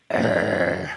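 low, guttural imitated growl; dog growl. Pastor imitating the rescue dog growling at him, low mock growl. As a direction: growl like a wary terrier baring its teeth. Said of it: "and he'd go".

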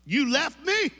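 high, loud mock-wounded question; hurt disbelief. To the airline lady, high mock-hurt disbelief. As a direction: pretend the plane abandoned you. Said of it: "I went".

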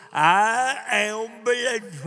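half-speed refrain, pushed a little harder; slow declaration. Shown as a demonstration. Preacher repeats his story instead of problem, slow emphatic proclamation. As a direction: proclaim it long and firm.

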